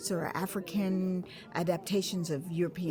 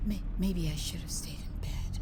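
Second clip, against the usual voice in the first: doubtful whisper; 3 semitones lower than usual. Acted. Aching before the party, a doubtful whisper. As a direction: whisper it to yourself, unsure.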